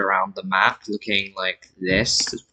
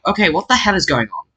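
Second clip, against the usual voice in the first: higher, bewildered exclamation as if lost at sea; mock confused; 8 semitones higher, faster than usual. Irish explorers finding Denmark again, higher mock bewilderment. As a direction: play the lost Irish explorers, baffled and exasperated. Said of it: "they're very confused".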